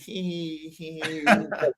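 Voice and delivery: put-on tittering "hee hee"; high, drawn-out fake giggle; mock girly giggle. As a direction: imitate girls giggling. Imitates girls' giggling.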